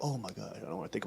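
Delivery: barely audible, low squeamish mumble; mock weak dread. Viewer shutting off at nuclear mushrooms, low faint mock dread. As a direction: mimic shrinking away from it under your breath.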